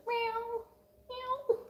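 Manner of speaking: high, soft kitten meows; imitated meows. Owner imitating her cats as tiny mewing kittens. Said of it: "They're like".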